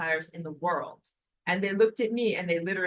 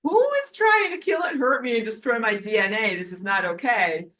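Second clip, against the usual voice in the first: louder, higher, affronted; indignant outrage; 4 semitones higher, 7 dB louder than usual. Retold or imitated. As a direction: demand answers, offended. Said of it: "I had to be like".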